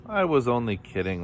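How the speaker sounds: usual speaking voice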